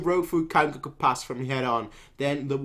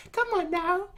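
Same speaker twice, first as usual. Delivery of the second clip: high mocking taunt; 4 dB quieter than usual. Kanki taunting the enemy, high teasing Come on now. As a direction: Come on now said much higher, teasing rise. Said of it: "Kanki's like".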